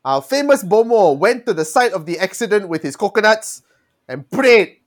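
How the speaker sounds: normal talking voice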